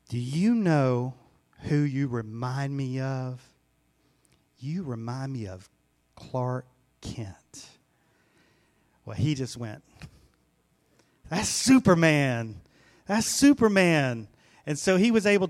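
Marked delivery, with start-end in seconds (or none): 0.1-7.7 slow, softening to a whisper on the name; teacher confiding a special secret slowly; teacher's gentle reveal. Retold or imitated. Teacher reassures teased boy in story. 11.3-14.3 slow, drawn-out wonder, swelling on the hero's name; acted-out boyish amazement, pitch swooping down; imitated awestruck boy. Boy reacts to teacher's comparison.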